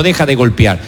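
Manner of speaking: a hint of a final divine word; God's voice, lightly. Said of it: "hasta que Dios te dijo".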